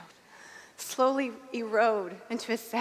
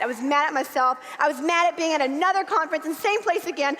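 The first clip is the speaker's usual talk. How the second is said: usual speaking voice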